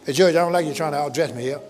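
ordinary delivery

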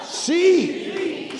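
high ringing word; called-out answer. Real, not acted. Preacher calling answer.

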